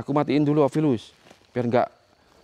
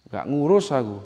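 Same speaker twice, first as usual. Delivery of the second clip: ordinary delivery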